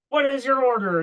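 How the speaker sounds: high, loud impatient demand. Retold or imitated. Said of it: "they're like".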